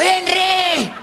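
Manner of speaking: high, strained parrot call; hoarse parrot. Acted. Parrot after calling all afternoon, gone hoarse. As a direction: squawk as if the voice is giving out. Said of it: "ya el loro afónico".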